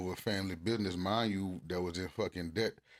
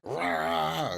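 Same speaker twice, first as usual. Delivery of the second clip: sudden higher, louder yelled Rah; hollering; 9 semitones higher, 5 dB louder than usual. Retold or imitated. Husband retelling how he hollers at his wife, loud yell. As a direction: bark it out like a frustrated yell. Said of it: "hooping and hollering like".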